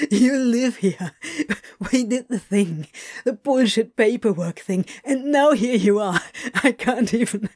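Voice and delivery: talking through helpless laughter; words breaking into giggles; laughing. A narrator's reading. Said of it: "Crowley just laughed harder".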